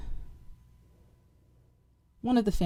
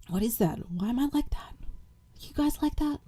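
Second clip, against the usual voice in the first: whispering; 6 semitones higher than usual. Real, not acted. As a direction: whisper the question to viewers. Whispered rhetorical questions.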